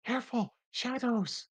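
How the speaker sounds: high-pitched wisp cry of warning; calling out. Acted. Wisp warns the party of shadows below deck, calling out high. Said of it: "calling out".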